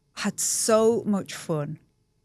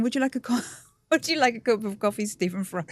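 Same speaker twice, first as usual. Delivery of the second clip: flustered, giggling through it, voice pitched up; nervous laugh; 5 semitones higher, faster than usual. Retold or imitated. Offering a celebrity coffee, nervous and giggling. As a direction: stammer it out, nervous and starstruck. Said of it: "I was so nervous".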